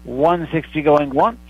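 slow auctioneer call, going once; auctioneer calling it slowly. Acted. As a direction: draw out each call before the sale. Going once, going twice, sold.

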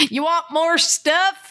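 high, slow teasing sales pitch with a laugh; mock salesy pitch. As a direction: dangle it like a cheesy salesman. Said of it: "And I'm like".

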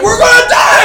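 very high, full-volume panicked scream; comic re-enactment of utter panic; freaking-out scream. Retold or imitated. Pranked friend tackled in the hall, freaking-out scream. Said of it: "he's, like, freaking out".

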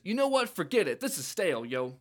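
high-pitched, bratty dismissal; high bratty Beat voice. Acted. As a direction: scoff it off like a sulky teen. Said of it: "And Beat says".

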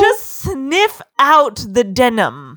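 very slow, savoring each word; mock-sensual slow read; slow sniffing Edward. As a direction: drag it out like a connoisseur. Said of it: "And Edward's like".

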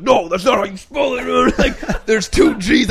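high, loud mocking dad voice, laughing; dad correcting. Son mocking dad correcting Tigger spelling, high and laughing. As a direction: lampoon the father's insistence. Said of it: "my dad was like".

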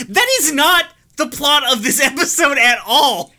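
high, loud, laughing indignation; exasperated outrage. Real, not acted. Host ranting about the official synopsis, high and loud. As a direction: rant at the bad synopsis, half laughing. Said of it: "I'm gonna be done being angry".

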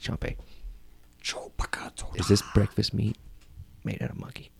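soft question with heavy pauses; hushed interrogation. Acted. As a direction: quietly close in on the servant, pausing. Master interrogates servant.

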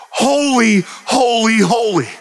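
high, drawn-out call, word by word; seraph outdoing another. Acted. Seraph showing the other how to praise, high spaced-out calling. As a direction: give each holy its own lofty cry. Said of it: "listen to this".

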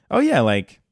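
light, high, chirpy chatter; cheery talk-show host. Retold or imitated. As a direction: gush about family like a cheery host. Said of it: "side conversation is like".

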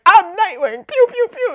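very high, loud silly hero voice; goofy fake Nightwing. Jason in a fake costume, very high goofy bravado. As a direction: play a silly fake hero with sound effects. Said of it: "He's like".

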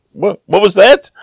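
high, loud startled question; startled demand. Retold or imitated. Hiker stopping the woman who got cured, startled and urgent. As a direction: pounce on the remark in mock alarm. Startled at her casual remark.

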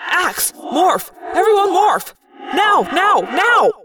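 high, loud bursts of urgent orders; yelled commands. A narrator's reading. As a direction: yell orders to the team under fire. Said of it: "I yelled".

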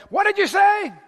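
irritated snap. Retold or imitated. Fasting husband snapping at his wife, irritated. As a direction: bite her head off with the question. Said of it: "and I".

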